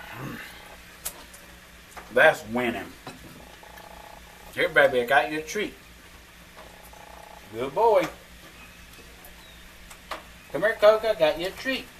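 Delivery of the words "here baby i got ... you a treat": sweet pet-talk voice. Real, not acted. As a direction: coax the dogs with a treat. Talking sweetly to pets.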